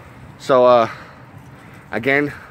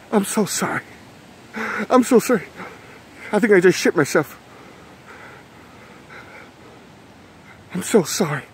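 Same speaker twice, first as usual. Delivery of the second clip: high, pitiful mock-terrified apology; frightened apology; 6 semitones higher than usual. Playing the chased horror victim apologising, high scared voice. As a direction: plead sorry as if scared witless.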